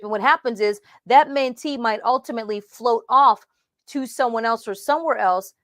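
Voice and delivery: ordinary talk, nothing marked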